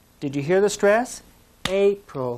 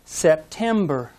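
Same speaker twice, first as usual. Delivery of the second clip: September slow, second syllable stressed; sep-TEM-ber, slow; slower than usual. Shown as a demonstration. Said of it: "Listen carefully to this next one".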